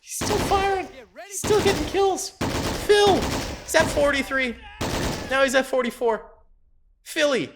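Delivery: high, delighted cheering; excited. Real, not acted. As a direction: cheer him on, thrilled. Cheering sniper again.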